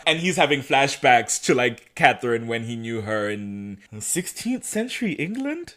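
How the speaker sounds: ordinary talk, nothing marked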